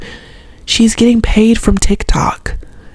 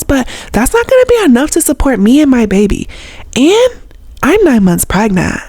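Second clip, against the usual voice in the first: higher-pitched, hammed-up young mom voice-over; teen mom narrator; 6 semitones higher than usual. Pregnant teen mom introducing herself on TV, imitated voice-over. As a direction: introduce yourself like a reality show teen, pitched up. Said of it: "In the beginning, they would be like".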